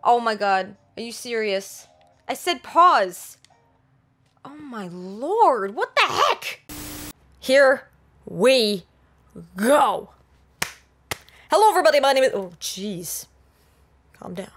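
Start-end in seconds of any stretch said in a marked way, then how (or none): 4.4-6.7 rising squeal of disbelief; building exasperation. Real, not acted. 7.4-10.1 each word separated and stretched; slow, deliberate, loud count-in; drawn-out restart. Real, not acted. Spaced-out restart of a take.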